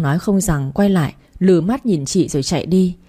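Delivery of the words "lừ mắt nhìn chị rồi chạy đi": ordinary delivery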